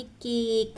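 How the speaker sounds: केक with a long, held ए; long vowel. Shown as a demonstration. Teacher showing how we will read it, long vowel. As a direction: stretch out the ए in केक. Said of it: "long हो गई".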